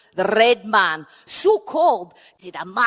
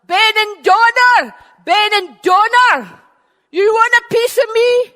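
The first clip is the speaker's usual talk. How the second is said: shouting across; 11 semitones higher, 11 dB louder than usual. Acted. Finn calling his enemy across the sea, shouting. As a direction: bellow his name across the water. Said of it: "shouted across to Benandonner".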